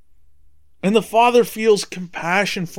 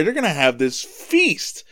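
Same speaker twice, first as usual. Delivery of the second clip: ordinary talk, nothing marked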